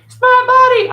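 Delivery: high, loud mock-indignant "It's my body"; mocking objector. Mimicking critics who'll push back, shrill mock protest. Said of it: "I might get some heat for that shit".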